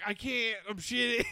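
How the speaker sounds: high, strained call back; acted straining, pitched high; grunting strain. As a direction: answer while pushing hard.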